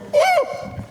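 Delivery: put-on sudden high, playful kid squeal; a burst of wordless kid joy; kid's squeal. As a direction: squeal with childish delight out of nowhere. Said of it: "all of a sudden they go".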